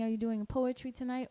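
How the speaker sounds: normal talking voice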